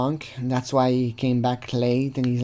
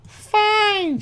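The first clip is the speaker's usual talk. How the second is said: put-on high falsetto sulky girl; mock girl voice. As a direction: snap it in a squeaky girly pout. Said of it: "she's like".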